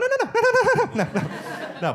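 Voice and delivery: mock panic at the word cancer; panicked denial. Patient reacting to the doctor's warning, high-pitched panic. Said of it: "I was like".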